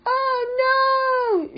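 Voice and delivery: very high, drawn-out wail; shout. A narrator's reading. Viv as the car goes down the mountain, high panicked shout. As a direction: cry out high in storybook panic. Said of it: "shouted Viv".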